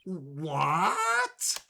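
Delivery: long, rising cry of disbelief; aghast theatrical yelp; shocked outcry. Acted. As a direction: stretch it into a stunned wail. Accused character reacting in shock.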